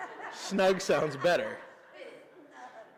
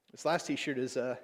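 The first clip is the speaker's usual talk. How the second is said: really choked up, halting softly; trying to hold it together mid-sentence; 2 semitones lower, 6 dB quieter than usual. Real, not acted. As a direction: fight back emotion and break off. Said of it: "getting all choked up over this".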